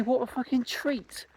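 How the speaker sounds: normal talking voice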